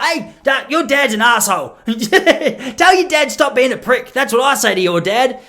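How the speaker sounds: mock rant. Mock telling off the dad.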